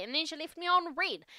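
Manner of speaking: a lightly voiced teenage girl venting; teen daughter voice. Teen daughter venting to her dad, lightly voiced. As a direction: play it as an upset teen unloading. [name] retelling the drama.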